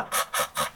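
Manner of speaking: はっはっは made with friction at the back of the tongue; French r fricative. Shown as a demonstration. Teacher demonstrating the French R friction inside は. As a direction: rasp はっはっは against the soft palate like a French R. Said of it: "っていう音なんですよ".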